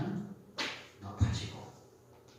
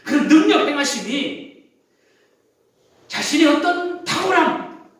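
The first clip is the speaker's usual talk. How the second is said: much higher and louder, driving the point home; preaching at full force; 12 semitones higher, 12 dB louder than usual. Acted. Preacher raises voice mid-sermon. (힘주어 높게 외치는 설교)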